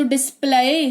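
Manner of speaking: डिस्प्ले with a wrong "eye" glide at the end; mispronounced demo. Shown as a demonstration. Teacher showing learners the mistake, डिस्प्ले mispronounced as "dis-plai". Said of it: "ऐसा नहीं हो रहा है".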